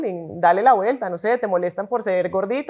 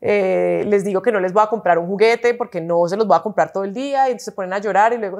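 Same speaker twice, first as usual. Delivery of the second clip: ordinary talk, nothing marked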